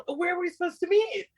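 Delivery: high-pitched mock-panicked group question; retold alarmed question. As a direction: voice jumps up, acting out sudden confusion. Retold panicked question among lost teens.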